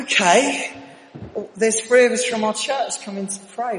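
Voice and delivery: loud, high-pitched retelling of reluctant consent; exasperated agreement. Retold or imitated. Agreeing to the pushy caller's dinner invitation, exasperated. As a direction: give in loudly, voice pushed up.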